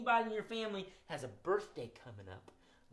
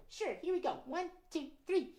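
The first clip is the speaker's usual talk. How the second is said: high timid puppet muskrat; nervous puppet; 13 semitones higher than usual. Acted. Puppet Gus speaks.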